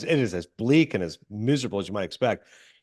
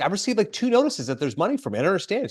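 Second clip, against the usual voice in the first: higher-pitched, indignant retelling; mock mad complaint; 7 semitones higher, faster than usual. Woman complaining about the money notices, mock mad. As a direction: act out her exasperation lightly. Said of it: "she gets mad".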